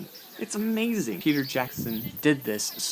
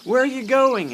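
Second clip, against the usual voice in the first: high, loud call after someone; yelled question; 13 semitones higher, 8 dB louder than usual. Retold or imitated. Guide voicing someone calling after Bilbo, yelling. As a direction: yell it out, pitched well up. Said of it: "someone yells out".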